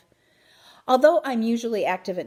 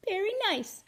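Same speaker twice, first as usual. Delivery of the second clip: hamster voice; 13 semitones higher than usual. A narrator's reading. Hamster voice in story.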